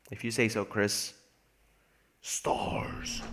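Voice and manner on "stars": low whispered monster voice; Stars voice. Pretend. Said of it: "I can make a Stars voice".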